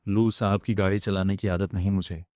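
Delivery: usual speaking voice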